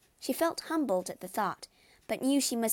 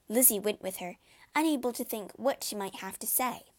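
plain reading voice

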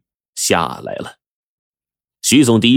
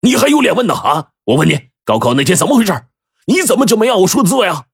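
high, angry scolding of a son; furious; 10 semitones higher, faster than usual. A narrator's reading. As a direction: demand an answer in a heated, high voice. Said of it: "徐总怒气冲冲地说". (怒气冲冲地高声质问)

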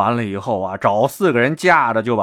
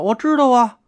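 eager reply; 12 semitones higher than usual. A narrator's reading. Eager answer to gang.

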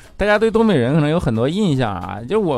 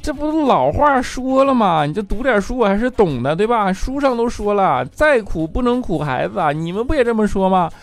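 put-on kid pleading; 7 semitones higher than usual. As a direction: lecture them like an indignant child. Kid arguing with parents.

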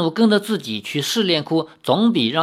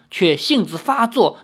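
ordinary delivery

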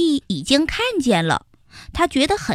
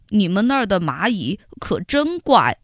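wondering ant; 4 semitones lower than usual. Acted. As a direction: muse on it in disbelief. Xiaoyi's take of the phrase. (小易将信将疑地说)

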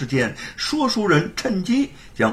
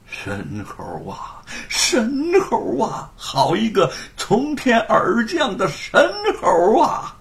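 excited murmur; 7 semitones higher, slower than usual. A narrator's reading. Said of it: "兴奋地喃喃自语".

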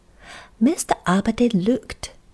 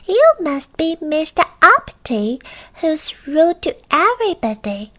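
high little goblin; high-pitched knowing creature; 9 semitones higher, 4 dB louder than usual. Acted. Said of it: "a little voice".

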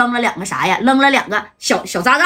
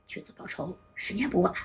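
unvoiced, much softer whisper; whispered thought; 2 semitones lower, 8 dB quieter than usual. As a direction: hiss the vow under your breath. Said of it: "这三哥合计".

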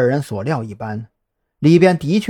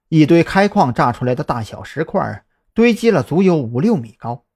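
usual speaking voice

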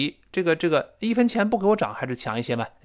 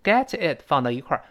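ordinary talk

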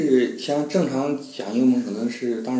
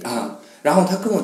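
ordinary talk, nothing marked

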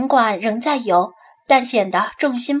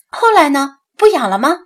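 higher, louder curious questioning; 3 semitones higher, 7 dB louder than usual. A narrator's reading. Asking what became of the fish, curious. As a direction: ask eagerly, pitched up. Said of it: "蒋菲菲好奇地问道".

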